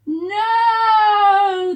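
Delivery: very loud, high cry of alarm; crowd yelling. Acted. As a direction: yell it with the whole crowd. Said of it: "the crowd yelled".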